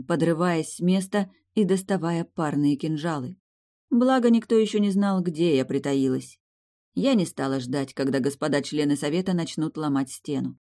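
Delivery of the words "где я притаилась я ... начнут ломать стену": plain reading voice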